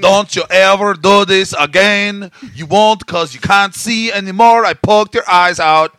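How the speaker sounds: loud, slow, high mock self-defense instructor; Boss Rutan impression. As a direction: yell it like a cheesy self-defense teacher. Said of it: "Where he's like".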